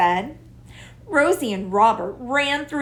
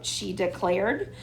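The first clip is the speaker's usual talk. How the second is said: plain reading voice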